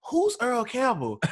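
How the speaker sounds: high, slow innocent question; mock-naive high voice; imitating wife. Wife leaning in, asking about Earl, high and slow.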